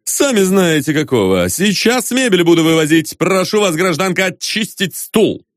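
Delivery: firm, emphatic official severity; stern order. A narrator's reading. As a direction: order them about like a bailiff. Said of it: "строго проговорил Остап".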